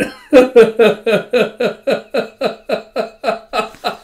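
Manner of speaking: long, high wheezy laugh; helpless run of giggles that keeps going; real laughing fit. Real, not acted. Narrator cracking up while retelling manga, real laughing fit. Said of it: "laughing is just too dangerous for me".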